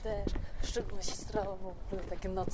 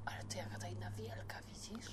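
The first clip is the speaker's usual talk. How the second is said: quiet at night; 2 semitones lower, 11 dB quieter than usual. Real, not acted. Walking outside at night.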